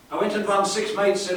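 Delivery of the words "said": ordinary talk, nothing marked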